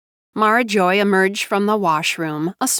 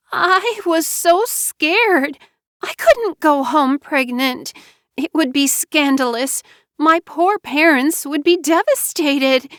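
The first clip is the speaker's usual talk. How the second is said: self-pitying Mara Joy; 8 semitones higher than usual. Acted. Wife explaining her pregnancy, high teary plea. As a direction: act wounded and scared.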